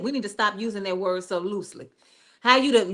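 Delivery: usual speaking voice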